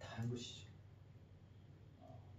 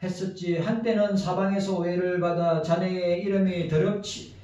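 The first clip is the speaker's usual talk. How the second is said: formal memorial letter read aloud, louder and higher; eulogy reading; 4 semitones higher, 11 dB louder than usual. Acted. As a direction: read out a farewell letter to a late friend, projected and steady. Reads a memorial letter to a friend. (고인이 된 친구에게 바치는 조사 낭독)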